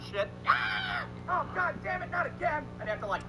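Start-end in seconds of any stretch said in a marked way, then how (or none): 0.5-1.0 very high mock shriek; imitated scream. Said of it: "scream like my fucking house is on fire". 1.3-2.6 high, mock-exasperated groan of words. Woken again by screaming friend.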